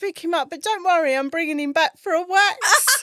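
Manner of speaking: laughing. Retold or imitated. Retold words to husband, giggling.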